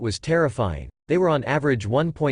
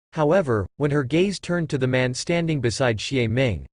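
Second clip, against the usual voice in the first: ordinary delivery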